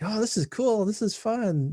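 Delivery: high, surprised kid voice, mom's retold kids amazed; like a kid finding an old game is cool; kids impressed. Dad retells his kids being impressed by old Mario games, high and excited. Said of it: "they were like".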